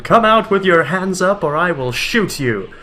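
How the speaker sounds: ordinary talk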